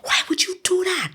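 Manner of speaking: high-pitched wailing protest; retold tearful wail of betrayal; imitated bawling. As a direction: plead through a mock sob, pitched very high. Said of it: "I just start bawling".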